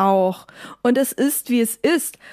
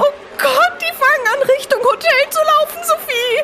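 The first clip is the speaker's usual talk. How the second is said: acted panic. Seeing animals run toward the hotel, tearful panic. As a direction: shriek it with tearful fear.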